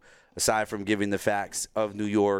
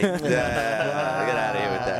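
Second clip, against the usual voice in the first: dismissive scoff; slower than usual. Retold or imitated. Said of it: "And I'm just like".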